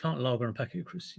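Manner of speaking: quick rattled-off order. Shown as a demonstration. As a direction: rattle it off like a regular. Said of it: "repeating the order".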